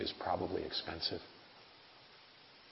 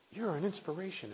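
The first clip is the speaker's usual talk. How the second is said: mock admirer; 10 semitones higher than usual. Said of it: "these people who go".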